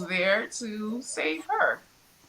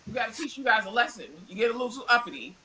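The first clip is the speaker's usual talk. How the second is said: fast mock sneer; much faster than usual. Mob mocking tone.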